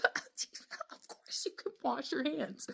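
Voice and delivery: slow, breathy whisper; awed whisper. Retold or imitated. Smitten woman lets her date wash his hands, whispering. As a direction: whisper it as if weak-kneed.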